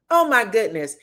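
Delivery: usual speaking voice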